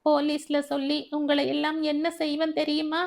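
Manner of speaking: put-on a tearful girl threatening her captors with the police; sobbing threat. A narrator's reading. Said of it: "அவள் விம்மினாள்".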